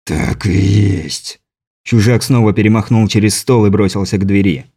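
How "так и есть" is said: low, slow inhuman voice; slow and threatening, from deep down; menacing alien. A narrator's reading. (низкий зловещий голос чужака)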